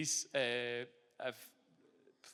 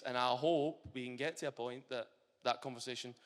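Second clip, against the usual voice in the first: normal talking voice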